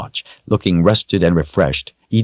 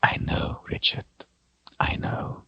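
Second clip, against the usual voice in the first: much softer, gentle whispered comfort; tender whisper; 11 dB quieter than usual. A narrator's reading. Zed holds the crying boy and whispers comfort. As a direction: hush him like a grieving child. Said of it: "spoke tenderly".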